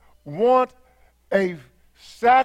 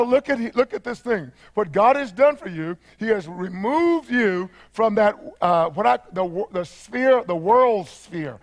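ordinary talk, nothing marked

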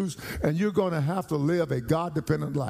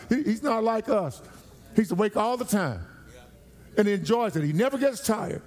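raised, driving preacher voice, pitched higher; full-force pulpit preaching, higher pitch; fervent preaching; 4 semitones higher than usual. Acted. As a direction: preach it out to the congregation, building.

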